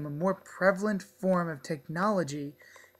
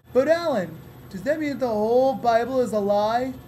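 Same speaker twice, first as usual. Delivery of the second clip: higher, louder questioning voice; curious viewer voice; 4 semitones higher, 4 dB louder than usual. Acted. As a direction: ask like a worried fan. Put-on viewer voice asking.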